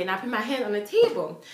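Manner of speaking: ordinary delivery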